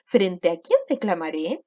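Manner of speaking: slow question, QUIÉN stressed, rising at the end; demonstrated question intonation, drawn out. Shown as a demonstration. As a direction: lean on QUIÉN and lift the end of DECLAMARÉ into a question. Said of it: "estoy preguntando".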